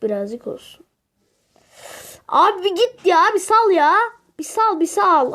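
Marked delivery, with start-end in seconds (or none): 2.3-5.3 exasperated shout. Real, not acted. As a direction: beg him to let go. Yelling at enemy in game.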